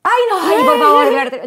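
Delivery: high-pitched, excited mock fangirl reaction; starstruck gushing. Wife reacting to husband filming a famous singer, starstruck gushing. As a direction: gush over a pop star, voice jumping up.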